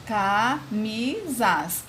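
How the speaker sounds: "camisas" very slowly, in three separate beats; syllable by syllable. Shown as a demonstration. Teacher showing the word has three parts, "camisas" slowly syllable by syllable. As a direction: split "camisas" as ca, mi, sas with pauses. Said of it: "ela é trissílaba".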